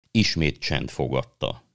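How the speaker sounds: plain reading voice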